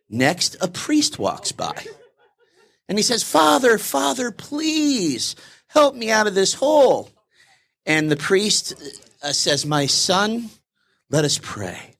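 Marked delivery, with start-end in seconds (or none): 3.2-7.1 high, slow plea to the priest; pleading to priest. Retold or imitated. Man in the hole begs a priest, high slow pleading. 9.6-11.9 priest voice. Retold or imitated. Priest answers man in hole.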